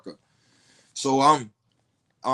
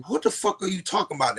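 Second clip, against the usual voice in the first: snapping; 8 semitones higher, faster than usual. Retold or imitated. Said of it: "that's when I start snapping".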